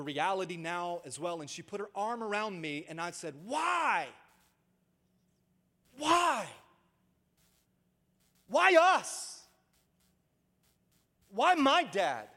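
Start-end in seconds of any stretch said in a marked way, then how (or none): 3.5-12.3 put-on high, loud retelling of a boy's sobbing why; retold anguished crying. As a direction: relive the grieving thirteen-year-old's outcry. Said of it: "I was yelling and screaming and crying".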